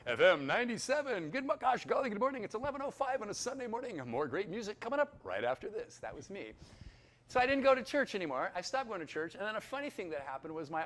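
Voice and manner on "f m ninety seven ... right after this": higher, louder cheesy DJ patter; radio DJ. Retold or imitated. DJ [name] announcing on Sunday morning radio, higher cheesy patter. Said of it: "That was my air name".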